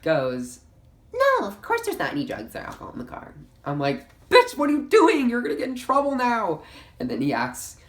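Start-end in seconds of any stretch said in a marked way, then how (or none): 1.1-3.3 very high, far-off put-on voice of a friend; mock airhead lie. Beth lying to the officer, very high and breezy. 4.3-6.6 much higher and louder, disbelieving shriek; exasperated yell. Retold or imitated. Teen inwardly screaming at her lying friend, high and loud.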